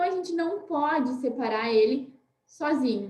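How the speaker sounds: ordinary talk, nothing marked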